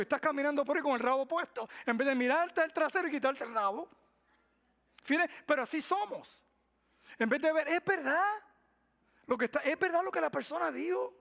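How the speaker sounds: loud, high impassioned sermon; fiery preaching. Acted. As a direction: preach it hard. Preaching at full force.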